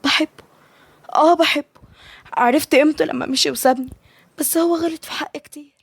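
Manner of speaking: high-pitched, hurt confession; plaintive, pitched well up; sad. A narrator's reading. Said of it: "مليكة بحزن".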